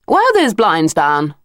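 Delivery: a touch of cool disapproval in the lady's voice; lightly voiced chilly lady; frosty. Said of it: "in a frosty voice".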